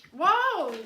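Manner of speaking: loud startled cry; startled whoa. Real, not acted. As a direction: react to a sudden knock. And I hit my head.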